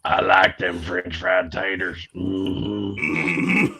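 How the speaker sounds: low slow Southern drawl mumble; slow and low, grunting Mm-hmm after; Sling Blade voice. Pretend. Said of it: "He went all Sling Blade".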